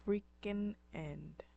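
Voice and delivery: ordinary talk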